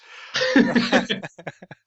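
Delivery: a burst of high, loud laughter; loud real laugh. Real, not acted. Laughing at their own slip.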